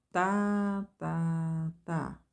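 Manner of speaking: accent the first "tá" of three; strong first beat. Shown as a demonstration. Teacher chanting the triple beat, first tá accented. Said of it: "destacar bem o que é forte".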